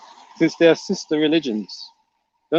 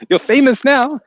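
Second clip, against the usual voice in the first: laughing, much higher and louder; teasing laugh; 10 semitones higher, 5 dB louder than usual. Real, not acted. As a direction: tease him through a laugh. Teasing a viewer about fame.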